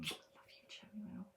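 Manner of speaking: hushed, almost voiceless whisper; soft whispered aside; whispered affection. Real, not acted. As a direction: whisper it privately to someone adored.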